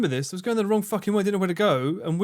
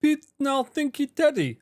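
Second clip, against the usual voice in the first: a much higher mock Teddy accent, broken English; 11 semitones higher than usual. Mocking Teddy's accent at the streamer, higher. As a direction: tease him in a high put-on Teddy accent. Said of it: "he tried to do Teddy accent".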